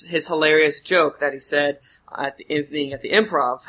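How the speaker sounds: normal talking voice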